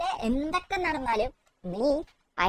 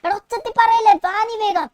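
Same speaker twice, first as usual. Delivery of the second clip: Shinchan voice; 3 semitones higher, 3 dB louder than usual. Acted.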